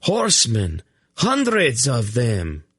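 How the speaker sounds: high, slow cry of alarm. A narrator's reading. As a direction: warn of the horde. Alarm at riders.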